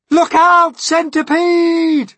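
very high, loud, drawn-out warning cry; James's warning shout. A narrator's reading. Boy warning centipede at the edge, urgent shout. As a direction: yell it out slowly and urgently. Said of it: "cried James".

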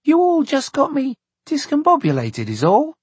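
put-on high-pitched flustered little girl; exclaiming. A narrator's reading. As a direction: protest in a child's high voice. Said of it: "she exclaimed".

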